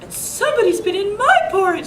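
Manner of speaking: a fussy high mama bear. A narrator's reading. Reading to kids, Mama Bear finds her porridge touched, high voice. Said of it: "said Mama Bear".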